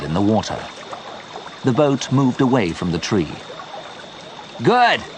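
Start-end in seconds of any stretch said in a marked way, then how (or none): 4.6-5.0 high triumphant shout. A narrator's reading. Joe shouting to Leon as the boat frees, triumphant. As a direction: cheer it out. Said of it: "Joe shouted to Leon".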